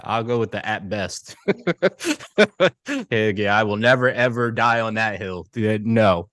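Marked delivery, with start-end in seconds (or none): 1.4-3.0 squeaky high burst of laughter. Real, not acted. Laughing at friend's jab.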